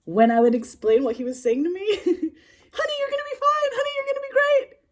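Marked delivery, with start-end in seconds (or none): 2.0-4.8 very high, loud gushing American cheerleader voice; over-the-top excited falsetto, American; excited American accent. Retold or imitated. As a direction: squeal encouragement in a put-on American accent. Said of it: "He had this voice".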